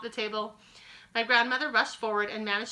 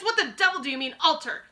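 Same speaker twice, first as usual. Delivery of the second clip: louder, higher furious demand; angry shout; 6 semitones higher, 6 dB louder than usual. Acted. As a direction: hammer the question at her. Mister Jenkins keeps shouting.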